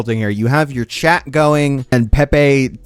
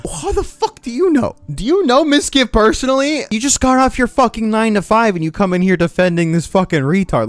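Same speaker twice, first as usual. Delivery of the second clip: high, heated ranting; irate challenge; 9 semitones higher than usual. Real, not acted. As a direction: lay into them, pitched up high. Heated retort to his defender.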